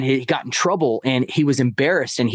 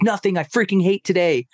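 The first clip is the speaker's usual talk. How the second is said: put-on higher, frustrated outburst of a fed-up twelve-year-old; imitating angry kid; 7 semitones higher than usual. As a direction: play the boy's angry complaint, pitched up. Angry stepson voiced in story.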